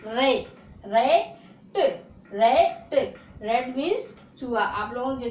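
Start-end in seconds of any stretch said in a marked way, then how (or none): 0.9-3.2 रेट with a clear e, not aa, said a bit louder; रेट spoken as the right version; correct reading. Shown as a demonstration. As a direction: model रेट firmly for the class. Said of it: "रा नहीं, रे".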